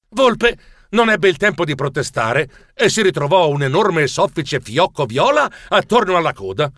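usual speaking voice

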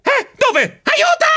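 very high yelp rising to a scream; terrified shriek. A narrator's reading. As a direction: shriek for help in panic. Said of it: "strillai terrorizzato". (strillo terrorizzato)

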